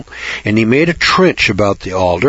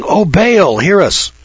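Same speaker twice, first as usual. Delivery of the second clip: pleading to Baal; 7 semitones higher, slower than usual. A narrator's reading. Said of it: "They called on Baal".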